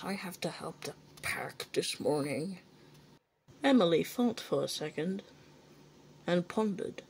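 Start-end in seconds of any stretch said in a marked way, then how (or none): 0.0-2.6 hushed whisper to himself. Acted. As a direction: whisper it under your breath. Henry grumbles about extra work.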